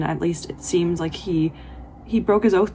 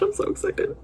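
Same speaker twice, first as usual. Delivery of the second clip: excited squeal; 3 dB quieter than usual. Real, not acted.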